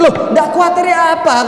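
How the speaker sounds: high, loud protesting woman; widow's high indignant protest to Musa; distressed widow. Acted. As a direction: whine back at the prophet.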